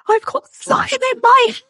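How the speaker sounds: high, strained mock shriek; imitated hysterical scream. Girl with slime in her hair, mock screaming. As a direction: squeal it like a screaming child. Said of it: "screamed at the top of her lungs".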